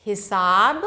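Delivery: ordinary talk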